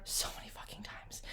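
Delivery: hushed confession to the viewer; whispered. Real, not acted. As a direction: drop to a raw whisper. Same line repeated as whisper.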